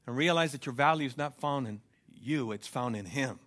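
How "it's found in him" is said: usual speaking voice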